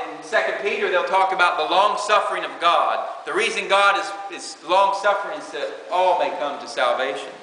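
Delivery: ordinary talk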